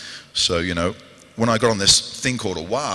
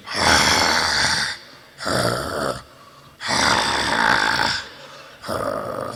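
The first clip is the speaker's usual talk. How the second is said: low drawn-out growls sinking lower; Azog growl; 8 semitones lower, much slower than usual. Shown as a demonstration. Azog turning round in the castle scene, deep growls. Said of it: "he turns around and he goes".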